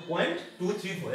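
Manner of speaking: Point, then टू three four as single digits; digit-by-digit reading. Shown as a demonstration. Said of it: "ऐसे पढ़ेंगे".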